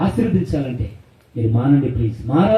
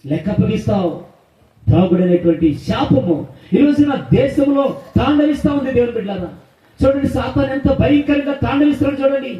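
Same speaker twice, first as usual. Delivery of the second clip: fiery preaching; 5 semitones higher than usual. Acted. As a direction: preach it hard to the congregation. Preaching climax. (ఉద్వేగభరితమైన ప్రసంగం)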